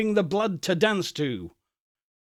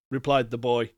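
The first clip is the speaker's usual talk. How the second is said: ordinary delivery, nothing marked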